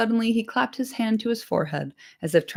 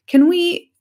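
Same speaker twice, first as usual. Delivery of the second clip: higher, louder eager hobbit; Frodo; 11 semitones higher, 7 dB louder than usual. Acted. Frodo trying to get the landlord's attention, higher and louder. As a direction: hobbit hailing a busy landlord.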